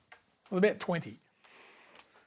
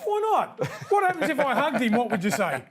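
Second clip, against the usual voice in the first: giggling as he teases about hugging the ref; laughing protest; 3 semitones higher than usual. Real, not acted. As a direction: laugh through the protest, pitch jumping up. Mock outrage over touching ref.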